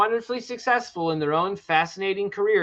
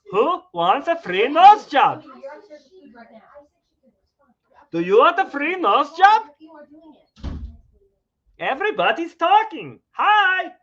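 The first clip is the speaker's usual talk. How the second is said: Doctor Nick impression; 10 semitones higher, 5 dB louder than usual. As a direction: sell it like a shady cartoon doctor. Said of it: "That's a really good Doctor Nick".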